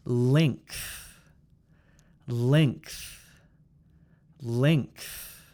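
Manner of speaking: low "Length" with throat ng moving up to th; NGTH ending. Shown as a demonstration. Teacher models for repeating, "Length" with ng plus th. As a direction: carry ng into a breathy th on "Length". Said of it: "the n sound comes from your throat".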